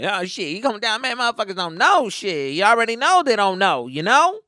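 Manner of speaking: high, loud street-talk impression; friend's swagger. Friend rambling about who knows what, imitated high swagger. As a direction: mimic his cocky rambling, rising at the end. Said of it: "he had that fucking swag".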